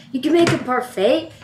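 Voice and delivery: ordinary talk, nothing marked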